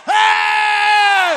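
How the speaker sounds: high, loud shouted hey; loud holler. Shown as a demonstration. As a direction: holler like at a football game. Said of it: "Why you can't holler".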